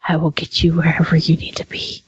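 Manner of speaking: whispering to the car; soft unvoiced promise. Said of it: "looking at it going".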